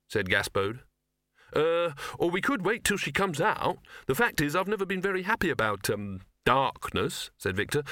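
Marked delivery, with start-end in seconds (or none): 1.5-7.3 nervous stalling. A narrator's reading. Victor nervous about entering darkness.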